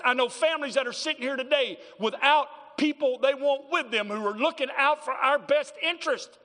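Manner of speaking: loud, high, driving pulpit preaching; fiery sermon delivery; preaching at full force. Acted. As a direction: preach it hard to the congregation.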